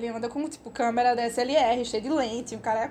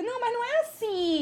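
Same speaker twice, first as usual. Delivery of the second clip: reassuring; 9 semitones higher than usual. Retold or imitated. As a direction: gently wave the worry away, pitched up. Reassuring a frightened foreigner.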